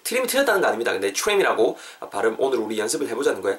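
ordinary talk, nothing marked

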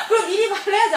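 hammed-up impatient telling-off; scolding bride. Acting the bride scolding groom.

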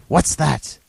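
sudden high startled "What's that?"; startled cry. Acted. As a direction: jump at the noise.

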